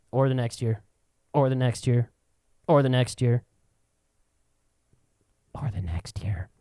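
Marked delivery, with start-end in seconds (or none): 5.5-6.5 whispered final item. Shown as a demonstration. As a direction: drop to a sly whisper. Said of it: "repeated list".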